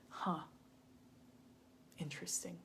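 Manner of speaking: breathy whisper, barely any voice; whispered huh. Retold or imitated. To her friend after harsh advice, a stunned whisper. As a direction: let it fall out as a stunned whisper.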